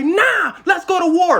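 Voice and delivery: high, loud rallying yell; mock war cry. Angry public demanding war, loud and high. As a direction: rile up the crowd, loud and high.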